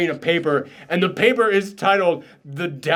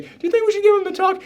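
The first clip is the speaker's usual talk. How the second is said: much higher, fast mock dad; 14 semitones higher, much faster than usual. Dad asking the mom about the talk, mock flustered high voice. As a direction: squeak it like a flustered father.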